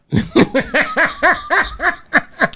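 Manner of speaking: high-pitched rolling laugh. Real, not acted. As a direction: crack up at your own joke. Joking about NFL-size fans.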